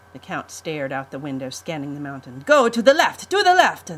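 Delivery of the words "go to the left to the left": high, loud urgent commands; shouted orders. Acted. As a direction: bark directions at the pilot. Count barking directions.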